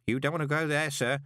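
high, brisker character voice; warning old postman. Acted. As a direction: warn him off in Grote's high voice.

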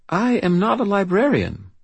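put-on high-pitched, grave little girl, faint British accent; serious British child. A narrator's reading. Child corrects the wizard about her job, serious British voice. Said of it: "serious and marked with a faint British accent".

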